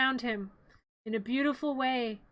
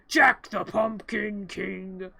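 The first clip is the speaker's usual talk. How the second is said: low, slow spooky figure voice; creepy mock proclamation; 4 semitones lower, much slower than usual. Playing Jack announcing himself with a creepy face, mock spooky. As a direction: drag it out low like a storybook monster.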